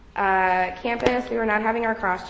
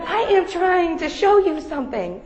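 higher, louder insistence; playfully insistent, higher; insistent mentor; 7 semitones higher, 4 dB louder than usual. Retold or imitated. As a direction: press the point firmly. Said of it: "She was like".

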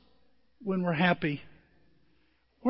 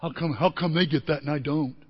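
mock jealous grumble; 6 semitones lower than usual. Mock jealous grumble about others' luck.

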